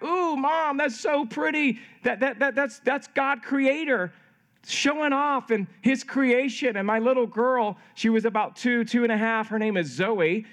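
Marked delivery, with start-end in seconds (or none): 0.0-6.7 lightly voiced children marveling, pitched high; mock childlike wonder in a higher voice; imitated awed kids. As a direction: play kids oohing at a sunset.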